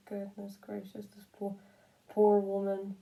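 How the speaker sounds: usual speaking voice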